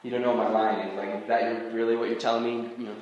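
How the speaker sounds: hesitant, subdued objection; doubtful objector. Acted. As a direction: push back softly, under your breath. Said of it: "You're like".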